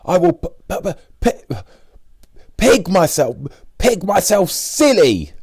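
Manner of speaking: very high, loud stammer bursting out; imitating Richard's stammer. Hungry Richard vowing to stuff himself, loud high stammer. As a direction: stutter on p, then explode with glee.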